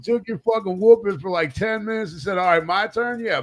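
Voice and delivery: high-pitched mock-outraged rant; high retold telling-off; acted indignant scolding.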